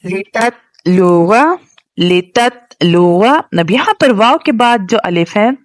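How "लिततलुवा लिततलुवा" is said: लिततलुवा said slowly with a long final vowel; slow recitation. Shown as a demonstration. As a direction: draw out each syllable of the word. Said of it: "recitation demo".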